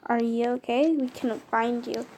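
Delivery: usual speaking voice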